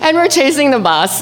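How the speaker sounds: normal talking voice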